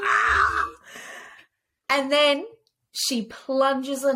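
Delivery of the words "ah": high scream. Acted. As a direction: let out a sharp high scream. Acted scream at stabbing.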